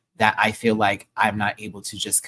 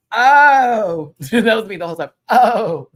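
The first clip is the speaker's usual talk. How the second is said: amazed; 10 semitones higher, 11 dB louder, slower than usual. Retold or imitated. Imitating own awed reaction.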